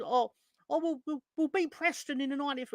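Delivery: high, silly mocking voice of a deluded fan; mock optimistic fan. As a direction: parody the fans who always believe. Mocking fans' false optimism.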